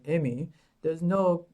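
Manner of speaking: slow, drawn-out dad impression; imitating her dad. Daughter quotes her gynecologist dad teasing her, slow put-on voice. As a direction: slowly put on her father's voice. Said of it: "and he talks like this too".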